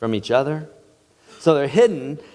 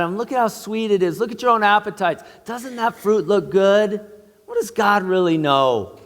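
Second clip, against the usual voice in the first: higher, coaxing tempter's voice; tempting serpent; 5 semitones higher than usual. Acted. Preacher voicing the serpent tempting Eve, coaxing. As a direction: sweet-talk them like the serpent.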